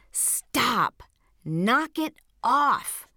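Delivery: firm command. Real, not acted. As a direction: shut down the negative voice firmly, drawing out the last words. Commands the negative voice to stop.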